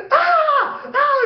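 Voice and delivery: very high mock scream; freaked-out scream. Kid terrified of alien parents, mock panicked screaming. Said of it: "I'm freaking out".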